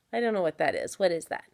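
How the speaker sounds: ordinary delivery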